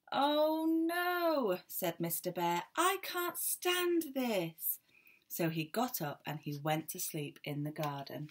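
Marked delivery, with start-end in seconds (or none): 0.1-1.6 much higher, louder wail of oh no; Mister Bear, woken again, wailing high; exasperated. Acted. Mister Bear kept awake again. 2.8-4.8 much higher, very slow drawn-out complaint; Mister Bear at the end of his tether, high and slow; exasperated wail. Acted. Mister Bear kept awake again.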